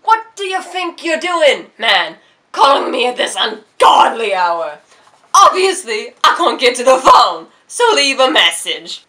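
loud, high, slow British rant; furious British voicemail; angry British yell. Retold or imitated. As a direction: scold the caller in a British accent. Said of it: "yells at you in an angry British voice".

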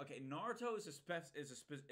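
ordinary delivery

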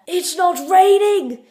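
loud, high, drawn-out exclamation; hammed-up high shout; indignant shout. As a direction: belt it out slowly in over-enthusiastic protest. Mock indignant cry to Americans.

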